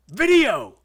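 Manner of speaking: high, blasting one-word shout; imitated boss yelling. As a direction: holler it like an outraged director. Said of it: "this guy yells a lot".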